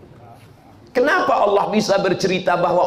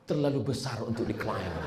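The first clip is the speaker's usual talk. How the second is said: much softer, fading out; faint dying words; 6 semitones lower, 11 dB quieter than usual. Retold or imitated. Preacher voices the agnostic at death, softer fading mock reply. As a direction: barely get the words out as life leaves you.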